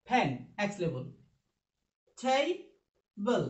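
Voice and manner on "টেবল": "টেবল" drawn out in two parts, higher pitched; teacher stretching "টেবল" syllable by syllable; broken into syllables. Shown as a demonstration. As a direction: split "টেবল" slowly into tay, pause, bul. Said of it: "তাহলে কয় syllable এটা".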